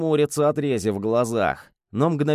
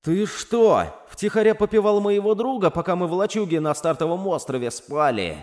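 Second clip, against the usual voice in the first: high, alarmed accusation; panicked; 6 semitones higher than usual. Acted.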